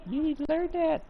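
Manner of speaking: playful high-pitched scold to the cat; high squeaky scolding. Real, not acted.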